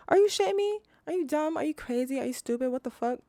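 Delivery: high-pitched burst of disbelief; appalled, scandalized tirade; incredulous outrage. Acted. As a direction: start high and loud, then rattle off the questions. Mock outrage at the low wage.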